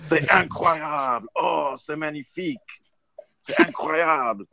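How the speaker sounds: put-on high, slow French accent; French commentator accent. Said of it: "it was the French commentators".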